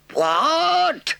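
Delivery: high, loud shocked cry; outraged disbelief; scandalized badger cries out. A narrator's reading. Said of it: "cried the badger, greatly scandalized".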